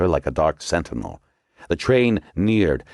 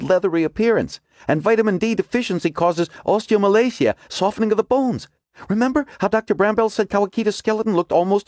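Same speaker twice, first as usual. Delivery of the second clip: higher, urgent woman character; Margot's voice; 13 semitones higher than usual. A narrator's reading. Margot explains her theory to the agents, urgent and higher. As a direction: voice Margot pressing her discovery.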